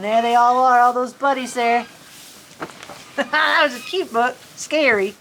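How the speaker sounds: ordinary delivery, nothing marked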